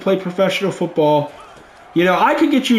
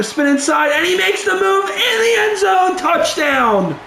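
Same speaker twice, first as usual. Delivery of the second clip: touchdown call; 11 semitones higher than usual. Real, not acted. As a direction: call the score like a thrilled announcer. Touchdown scored in the game.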